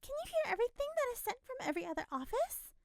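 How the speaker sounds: high, fast astonished question; nosy woman, eager; eager busybody. A narrator's reading. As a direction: rattle off the question in amazement.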